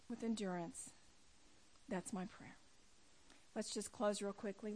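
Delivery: ordinary talk, nothing marked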